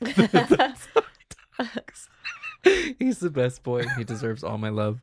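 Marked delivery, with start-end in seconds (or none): 0.0-2.1 laughing through it, slow and high. Real, not acted. Player repeats the joke to the table, laughing hard. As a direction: wheeze the words out mid laugh.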